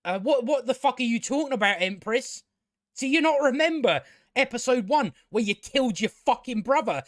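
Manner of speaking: usual speaking voice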